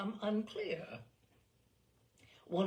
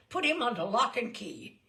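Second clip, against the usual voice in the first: commanding; 4 semitones higher, 7 dB louder than usual. Acted. General commanding the guards.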